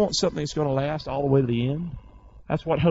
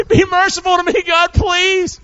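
high loud plea; desperate shouted plea; crying out; 12 semitones higher, 8 dB louder than usual. Retold or imitated. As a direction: cry out to God, loud and high. Crying out to God, voiced.

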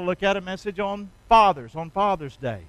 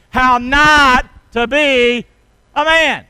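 NOT held long, slow and loud; slow emphatic call; 4 semitones higher, 10 dB louder, much slower than usual. Shown as a demonstration. Preacher chanting the title with the crowd, slow and loud. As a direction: drag out NOT and build to a higher a man. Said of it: "Say it with me".

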